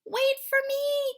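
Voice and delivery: high, loud squeaky mouse cry. Acted. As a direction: call out as a little mouse left behind. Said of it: "cried Mouse".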